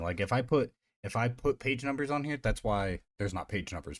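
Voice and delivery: ordinary talk, nothing marked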